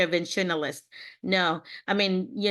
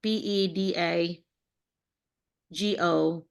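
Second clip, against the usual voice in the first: slow spelling; slower than usual. Shown as a demonstration. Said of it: "How do you say that word?".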